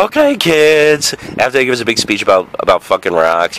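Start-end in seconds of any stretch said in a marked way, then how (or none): 0.1-1.1 slow, higher teacher voice; teacher addressing kids. Retold or imitated. Museum teacher calling the class, slow and drawn out. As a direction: stretch it like a guide calling the class. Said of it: "They go".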